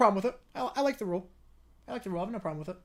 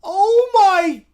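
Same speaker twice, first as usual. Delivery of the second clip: high, loud overjoyed screaming; ecstatic shout; 9 semitones higher, 12 dB louder, much slower than usual. Real, not acted. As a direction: scream with joy. Reacting to huge gift.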